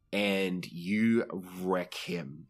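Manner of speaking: ordinary talk, nothing marked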